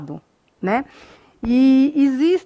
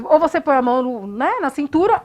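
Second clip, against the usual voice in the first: usual speaking voice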